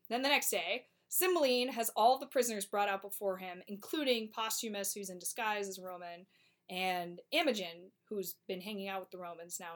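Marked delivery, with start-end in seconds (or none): none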